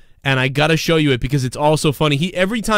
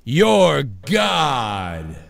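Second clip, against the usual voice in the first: mock god voice; much slower than usual. Joking after being called the God.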